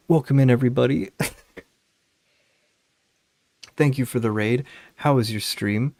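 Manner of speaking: streamer raid welcome. Acted.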